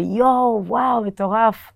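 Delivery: high, slow, dazed wonder; mimicking a stoned customer's slow wow; stoned awe. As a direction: play the high customer, stretched and amazed. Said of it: "הוא היה על סמים".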